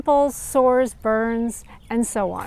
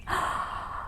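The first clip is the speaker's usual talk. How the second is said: gasp; 11 dB quieter than usual. Acted. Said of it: "she exclaimed".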